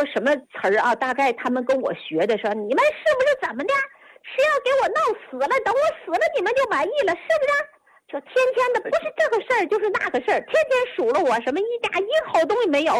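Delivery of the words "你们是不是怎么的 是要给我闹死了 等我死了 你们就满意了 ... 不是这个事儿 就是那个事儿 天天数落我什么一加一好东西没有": high, wailing tearful tirade, some lines fast; crying angry rant. Retold or imitated. Grandma on floor wails at family, high tearful rant. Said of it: "就哭什么玩意儿".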